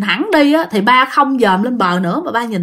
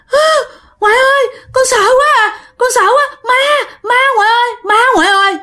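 high-pitched child's sobbing wail, acted out; imitated frightened crying; 10 semitones higher than usual. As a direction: wail in panic to grandma, mimicking a terrified child. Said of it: "Cái bà khóc lên, bà nói". (giả giọng trẻ con khóc thét vì sợ)

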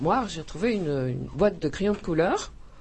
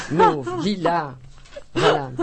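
much higher and louder, with a laugh; excited exclamation; 4 semitones higher, 5 dB louder, slower than usual. Real, not acted. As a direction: burst out with the colour names, laughing. Exclaims her favourite colour.

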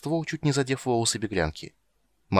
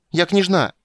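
put-on high, loud child's insistence; shrill tantrum; 10 semitones higher, 9 dB louder, slower than usual. A narrator's reading. Girl insists on her rank to the witcher, tantrum shout. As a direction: yell it like an offended little princess.

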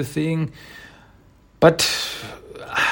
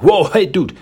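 louder, higher retold protest; sharp objection; 6 semitones higher, 5 dB louder than usual. Raph objects to talk about his girl, raised protest. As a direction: cut in with a raised mock protest.

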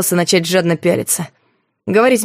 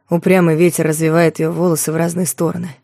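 ordinary delivery, nothing marked

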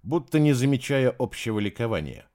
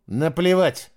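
curt; 5 semitones higher, 5 dB louder, slower than usual. A narrator's reading. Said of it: "срезала я".

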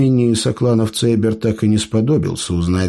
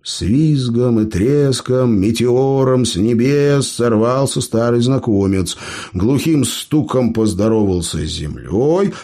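plain reading voice

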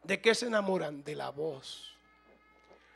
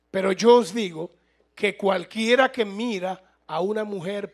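scripture reading; 4 dB louder than usual. Acted. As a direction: proclaim the verses like a preacher. Reads scripture aloud. (lectura bíblica solemne y predicada)

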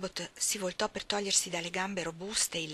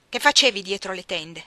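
louder bullying question; demanding question; 9 dB louder than usual. Acted. John Reed interrogates. (domanda prepotente e forte)

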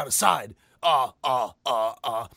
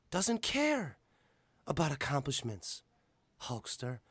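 Paul Heyman impression; 5 semitones higher, slower than usual. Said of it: "I would love to hear Paul Heyman just go".

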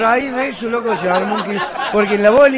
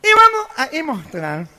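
imitated cleft-palate orator; gangoso president. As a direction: declare it laboriously as a gangoso leader. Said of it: "el presidente".

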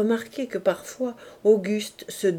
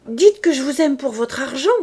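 raised, louder accusation flung at him; cried out; 4 semitones higher, 7 dB louder than usual. Acted. Woman shouting at her lover over money, cried out. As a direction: throw the words back at him. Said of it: "cria-t-elle".

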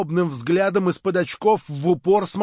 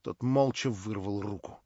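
ordinary delivery

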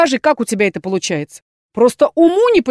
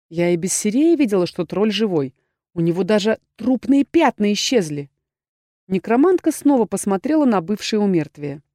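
plain reading voice

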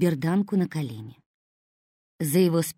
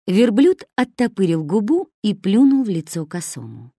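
ordinary delivery, nothing marked

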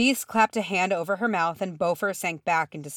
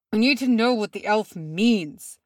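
raised, forceful snarl; angry, higher-pitched demand; 4 semitones higher, 4 dB louder than usual. A narrator's reading. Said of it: "Bombur snarled".

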